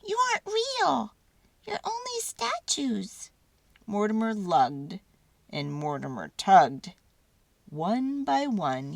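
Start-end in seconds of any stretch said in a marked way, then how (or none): 0.1-3.3 high, squeaky little mouse voice. A narrator's reading. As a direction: squeak it like a tiny mouse catching on. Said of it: "Mortimer squeaked".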